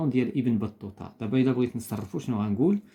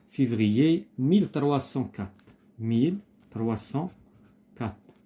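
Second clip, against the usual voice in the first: slow dictation; much slower than usual. Shown as a demonstration. Teacher dictates the answers.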